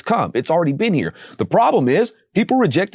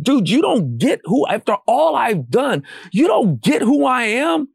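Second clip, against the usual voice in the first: incredulous Jesus; 6 semitones higher than usual. Retold or imitated. Christ voiced, exasperated.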